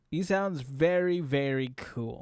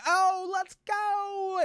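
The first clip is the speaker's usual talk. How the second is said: very high, slow stretched cheer; mock excited high holler; high-voiced outburst; much slower than usual. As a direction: imitate him shooting way up in pitch. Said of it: "then he comes back down here".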